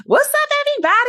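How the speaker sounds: put-on high, fast, bubbly college-kid radio greeting; college freshman host. Ex-host mocks her eighteen-year-old self on air, high squeaky greeting. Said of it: "I was talking the way I talk".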